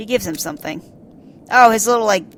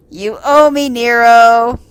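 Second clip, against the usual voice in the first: louder, smug line; smug tease; 3 semitones higher, 7 dB louder, slower than usual. Acted. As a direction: tease the rescued rival. Rescuer teasing.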